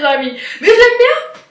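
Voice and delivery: laughing while talking; giggly, amused talk. Real, not acted. Laughing about Bretons and Parisians.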